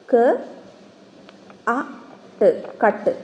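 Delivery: ക്, അ, ട് said one by one, then blended into കട്ട്; teacher blending sounds into a word; sounding out. Shown as a demonstration. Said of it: "ക്യാറ്റ് എന്നല്ലേ പറയുക".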